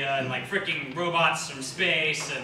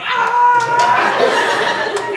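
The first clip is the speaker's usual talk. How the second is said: high, loud, held scream; cartoon scream; 12 dB louder than usual. Imagining Desi on Godzilla in a tornado, long scream. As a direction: let out a long silly shriek.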